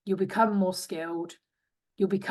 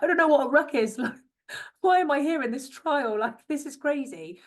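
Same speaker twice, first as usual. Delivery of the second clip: higher, baffled, half-laughing disbelief; bewildered self; 7 semitones higher than usual. Retold or imitated. Retelling her confusion at the rugby trial, mock bewildered. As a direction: play it like a confused newbie, light and amused.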